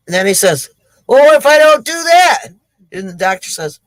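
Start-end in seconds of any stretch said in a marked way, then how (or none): 1.1-2.5 high, loud comic protest; alarmed question. Acted. Gold digger to doctor about sucking out poison, alarmed question. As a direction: balk in mock alarm.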